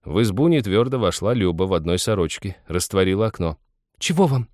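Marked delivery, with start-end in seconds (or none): 4.0-4.5 sharp, high-pitched cross question; irritated woman at the window. A narrator's reading. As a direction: demand it crossly. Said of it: "сердито спросила она".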